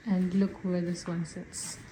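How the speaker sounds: usual speaking voice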